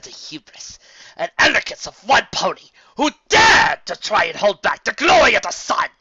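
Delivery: loud, high, building doom; vengeful prophecy. Acted. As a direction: curse him with a prophecy of ruin.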